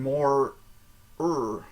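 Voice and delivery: "er" as a long, hard American R. Shown as a demonstration. Showing how Amsterdam people say R, exaggerated American "er". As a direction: hold the R in "er", curled American style. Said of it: "more like an American R".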